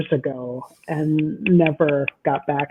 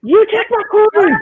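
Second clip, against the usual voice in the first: high, raised mock-yell comeback; retold yelled retort; 4 dB louder than usual. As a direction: fire it back as in a game squabble. Acted retort in retold game quarrel.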